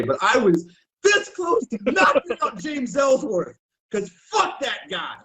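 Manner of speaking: real heated outburst; heated shout. Real, not acted. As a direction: vent the anger, voice rising. Still furious recalling the wrestler.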